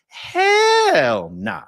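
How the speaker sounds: long, loud, high HELL then a short nah; emphatic refusal. Real, not acted. Streamer answering own rhetorical question, emphatic refusal. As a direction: draw out "Hell" loudly, drop onto "nah".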